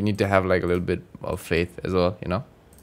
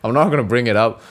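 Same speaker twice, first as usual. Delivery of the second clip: ordinary talk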